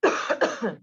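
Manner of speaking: throat-clearing apology. Real, not acted. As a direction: clear throat, then sorry. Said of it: "I'm coughing".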